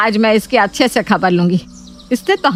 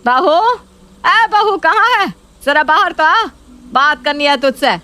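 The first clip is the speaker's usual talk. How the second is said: mother-in-law hollering for the bahu; angry calling out; 7 semitones higher than usual. Acted. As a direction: call her out of her room, sharply raised. Mother-in-law calls out angrily.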